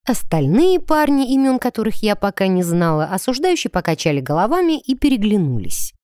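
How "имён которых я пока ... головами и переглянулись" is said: ordinary delivery, nothing marked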